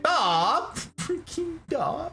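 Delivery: squeaky, embarrassed "aw"; overwhelmed by a kind compliment; flustered. Real, not acted. Streamer flustered by viewer praise, laughing.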